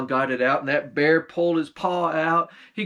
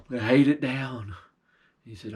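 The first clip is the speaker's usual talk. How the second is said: ordinary delivery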